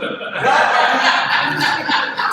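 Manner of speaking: big loud laugh, high and falling. Real, not acted. Speaker laughing along with the audience at his joke. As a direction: burst out laughing at your own joke.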